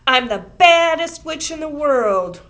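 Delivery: high loud witch yell; yelled witch boast. A narrator's reading. Ramona chasing Susan, yelling loud and high. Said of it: "yelled Ramona".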